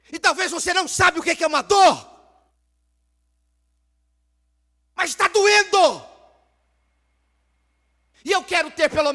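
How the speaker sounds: loud, high preaching cry of pain; impassioned sermon cry; anguished shout. Acted. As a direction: shout out her pain before God. Ana's anguish preached at full force.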